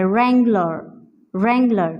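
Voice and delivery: wrangler said "rangler", no w; silent w in wrangler. Shown as a demonstration. Teacher drilling wrangler without w. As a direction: wrangler starting on r, w dropped. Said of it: "second syllable है उस पे हमने stress करना है".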